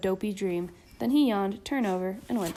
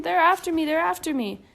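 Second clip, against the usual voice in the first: high, loud panicked cry, easing on the repeat; a boy running from dream monsters; panicked scream; 7 semitones higher, 5 dB louder than usual. A narrator's reading. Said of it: "he screamed".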